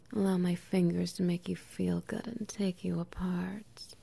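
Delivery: ordinary talk, nothing marked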